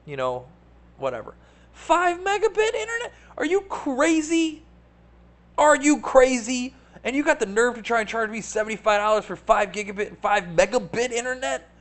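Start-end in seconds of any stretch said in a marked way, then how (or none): 1.7-11.7 much higher, incredulous voice; mock outrage. Ranting at the provider about the price, in a much higher outraged voice. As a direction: squeal it like you can't believe the nerve.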